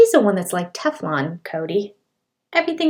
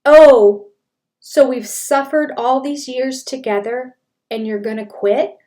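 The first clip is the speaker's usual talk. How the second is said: big loud "Oh", then a higher, disbelieving rising question; incredulous mock; 4 semitones higher, 8 dB louder than usual. Host mocking Cody's line to Christine, loud and incredulous. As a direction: throw his words back in disbelief. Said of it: "And I'm, like, going".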